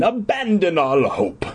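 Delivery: a hint of a ghostly jailer; strange voice. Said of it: "in a strange voice".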